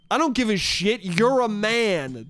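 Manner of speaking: "You're a man" slowed right down; MAN as insult. Shown as a demonstration. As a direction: drag out "man" as a pointed mock insult. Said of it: "That would be me calling her a man".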